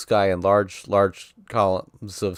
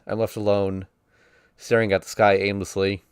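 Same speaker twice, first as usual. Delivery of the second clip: normal talking voice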